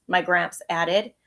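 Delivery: ordinary delivery, nothing marked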